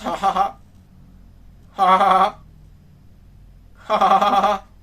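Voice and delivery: loud hammy evil ja ja ja; villain laugh. Acted. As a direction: ham up a mad scientist laugh. Host fakes villain laugh. (risa de villano exagerada)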